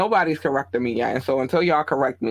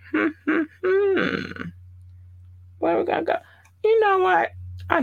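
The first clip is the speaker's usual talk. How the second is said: playful musing. Real, not acted. Playfully deciding what to play.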